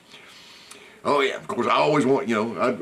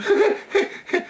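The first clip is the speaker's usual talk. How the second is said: a high squeal of a laugh; high burst of laughter. Real, not acted. Laughing to his viewers at the deer joke, high squealing laugh. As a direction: crack up at the joke.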